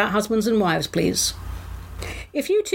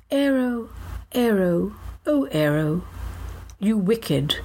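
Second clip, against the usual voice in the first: slow, savoured mock-grand verse; poem recital. Host reciting an ode to a chocolate bar, mock-grand verse. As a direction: linger on each phrase, sinking at line ends.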